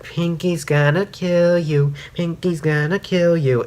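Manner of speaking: lightly voiced haunting inner voices; voices in her head. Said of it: "voices start going in Rainbow's head".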